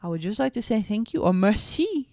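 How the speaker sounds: high, loud, badly faked French accent. Boyfriend apologising to mademoiselle, clumsy French accent. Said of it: "French accent".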